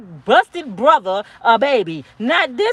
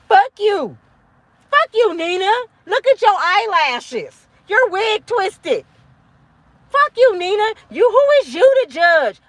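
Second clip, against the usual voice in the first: mock hater; 7 semitones higher than usual. Imitating angry commenters attacking her.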